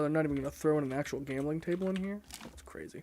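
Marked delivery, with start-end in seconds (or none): 2.4-3.0 breathy under-the-breath remark; whispered aside. Real, not acted. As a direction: drop to a whisper. Muttered aside while looking around.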